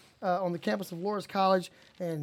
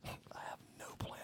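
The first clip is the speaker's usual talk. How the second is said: giggly prank whisper; 23 dB quieter than usual. Real, not acted. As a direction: whisper into the mic. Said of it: "Whispering into the mic".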